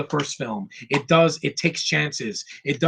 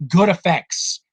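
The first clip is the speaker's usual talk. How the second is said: ordinary talk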